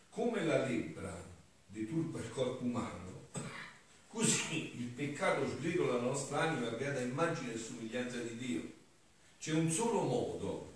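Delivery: forceful preaching. Acted. Priest to the congregation, preaching with force. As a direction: preach it out to the whole church.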